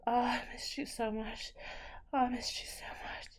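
whispered moans and gasps; pleading, breathless and aroused; breathy aroused lover. Acted. Acts out lovemaking in bed.

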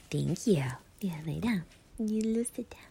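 hushed, soft whisper to the dog on her lap. Real, not acted. Owner soothing the dog climbing into her lap, whispering. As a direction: breathe the words to a sleepy pet. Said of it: "You guys want me to talk to you like that?".

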